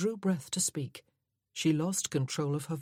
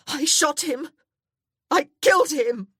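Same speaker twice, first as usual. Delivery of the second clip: a high, loud, breathless cry; panting shout; 11 semitones higher, 11 dB louder than usual. Acted. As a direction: scream it out between gasps. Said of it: "She was panting for breath".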